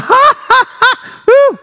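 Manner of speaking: high, loud laughing whoop; a gleeful laugh and high whoop. Retold or imitated. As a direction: laugh out freely behind the door. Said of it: "I'll be like".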